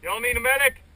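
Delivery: shouting across the field; man down shout. Real, not acted. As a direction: call out high and loud for a medic.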